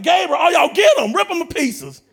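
loud, high, hammed-up battle order; mock wrathful shout. Preacher playing God sends angels after fools, mock furious shout. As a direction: sic the angels on them in comic fury.

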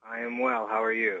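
deadpan machine voice; robot voice. Acted. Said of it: "speak like a robot".

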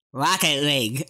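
talking with tongue pulled out; words blurred by a held tongue; holding tongue with fingers. Real, not acted. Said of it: "grab your tongue and hold it out and say this word".

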